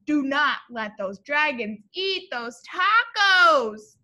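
ordinary talk

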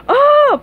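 very high, loud shriek; frightened shout. Acted. Patrick is shown the drawing again, shouting. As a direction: shriek on sight, cartoon fright. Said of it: "Patrick shouted".

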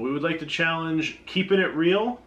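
ordinary talk, nothing marked

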